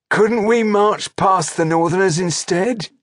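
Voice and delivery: high, loud orc underling calling out; challenging orc trooper. A narrator's reading. Orc trooper questioning his chieftain before the army, challenging. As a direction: pipe up loudly from the ranks, pitched high.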